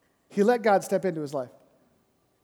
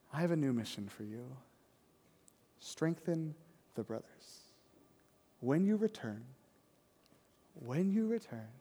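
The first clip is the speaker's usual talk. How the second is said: gentle commission; 5 semitones lower, 10 dB quieter than usual. Acted. Jesus voiced tenderly to Peter.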